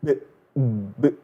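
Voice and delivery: b as a bare closed consonant with no vowel; isolated vowelless b; silent letter. Shown as a demonstration. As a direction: stop the sound on b without releasing a vowel. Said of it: "And the example will be".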